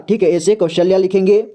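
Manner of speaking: usual speaking voice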